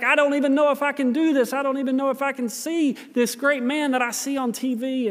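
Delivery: mildly agitated retelling, pitched high; angry and upset. Retold or imitated. Matthew frets before meeting his TV hero, mock-upset and high. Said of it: "He got angry and upset".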